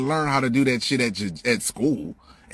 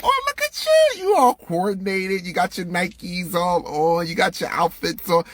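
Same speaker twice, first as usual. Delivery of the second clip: very high coo, then slower and higher than usual; hammed-up fake-nice compliments; mock gushing teacher; 7 semitones higher than usual. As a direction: pretend teacher admiring a kid's Nikes. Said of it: "And they're like".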